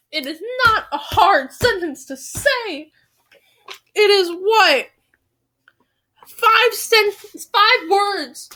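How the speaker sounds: high, worked-up self-scolding; exasperated. Real, not acted. Exasperated at own repeated flubs.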